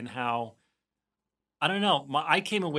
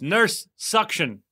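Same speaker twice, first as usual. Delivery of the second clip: slow mock order; 6 semitones higher, 6 dB louder, much slower than usual. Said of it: "what about when Todd goes".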